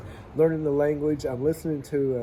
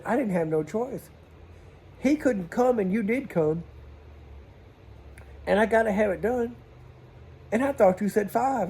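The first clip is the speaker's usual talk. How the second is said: customer's excuse; 7 semitones higher than usual. Retold or imitated. Voicing the customer defensively.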